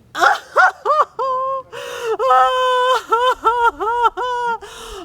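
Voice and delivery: loud, high fake wailing sob; hammed-up wailing sob. Wife wailing over her lost husband, hammed-up high sob. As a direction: wail it out like a grieving wife. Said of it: "weeping and sobbing inconsolably".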